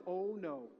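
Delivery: ordinary delivery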